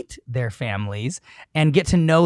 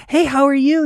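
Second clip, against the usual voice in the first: high greeting; 12 semitones higher, 4 dB louder than usual. Retold or imitated. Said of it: "I'm like".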